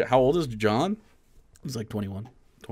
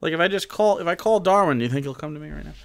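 ordinary delivery

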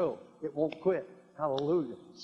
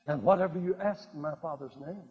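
normal talking voice

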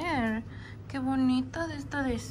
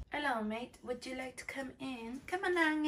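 ordinary talk

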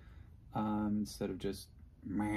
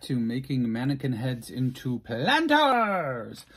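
cheesy show-host intro; 8 dB louder than usual. Pretend. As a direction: greet like an over-the-top TV host. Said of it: "great intro, right?".

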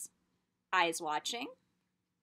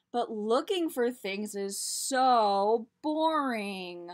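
bored whine; 10 dB louder, much slower than usual. A narrator's reading. Child complains.

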